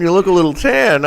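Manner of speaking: teasing coworker. Retold or imitated. Coworker teasing about tan.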